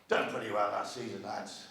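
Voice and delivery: quiet, low pretend team talk, no accent; Sir Alex Ferguson. Manager to his players, understated mock team talk. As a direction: softly play a manager addressing his players. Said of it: "Sir Alex said to his players".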